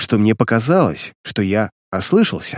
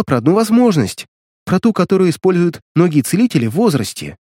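put-on higher girlish voice, defensive; young girl explaining; 6 semitones higher than usual. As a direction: justify herself like a young sister. Sister explains her deal.

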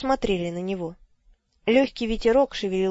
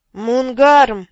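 loud hoarse call of a name; hoarse shout; 3 semitones higher, 10 dB louder than usual. Acted. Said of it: "хрипло крикнул Брокл".

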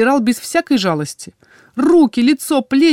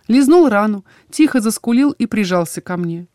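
ordinary delivery

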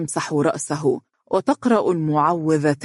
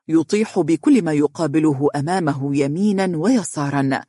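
ordinary delivery, nothing marked

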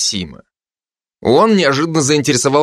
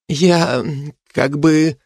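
higher-pitched, halting stammer; flustered; 4 semitones higher, much slower than usual. A narrator's reading. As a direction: lose your words mid-sentence. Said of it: "Совсем растерялась Кика". (растерянно, с заминкой)

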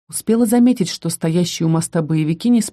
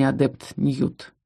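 slow, softer, drawn-out cold warning; icy slow warning; 4 semitones lower, 5 dB quieter, much slower than usual. A narrator's reading. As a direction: let the name hang, cold and slow. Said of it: "ледяным тоном выдавил магистр".

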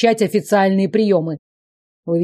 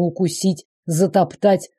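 ordinary delivery

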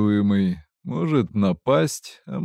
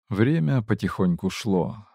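usual speaking voice

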